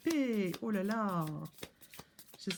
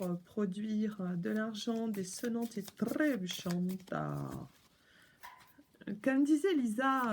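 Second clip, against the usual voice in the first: ordinary talk, nothing marked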